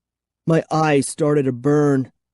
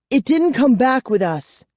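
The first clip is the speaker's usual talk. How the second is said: announced; 9 semitones higher than usual. A narrator's reading. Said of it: "Kara announced".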